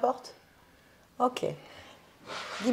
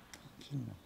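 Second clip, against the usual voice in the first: tranquillement breathed much softer and lower; soft murmur; 8 semitones lower, 15 dB quieter than usual. Shown as a demonstration. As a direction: let tranquillement fade to a hush.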